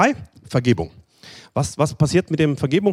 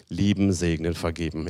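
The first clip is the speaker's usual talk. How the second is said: low and much softer, fading out; soft low refrain; 9 semitones lower, 7 dB quieter than usual. Shown as a demonstration. Preacher answering his own question, low and soft. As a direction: hush it like a gentle reminder. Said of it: "Was machen wir dann?".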